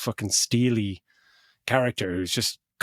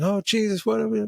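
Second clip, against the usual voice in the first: high-pitched panicky radio outburst, acted; imitated alarm; 12 semitones higher than usual. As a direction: mimic someone panicking over comms.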